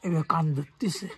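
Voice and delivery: slow, breathy whisper. Real, not acted. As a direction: whisper it slowly. Whispered aside.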